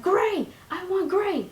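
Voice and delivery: high, loud, eager squeal; excited squeal. Retold or imitated. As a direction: blurt it out like a thrilled kid. Excited retold answer on phone.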